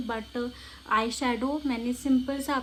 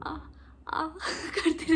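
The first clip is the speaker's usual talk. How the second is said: hammed-up pained moan, higher; fake moaning; 6 semitones higher, slower than usual. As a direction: fake a hurt moan for dad. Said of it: "इतना drama करूँगी". (पापा के सामने नाटकीय दर्द भरी कराह)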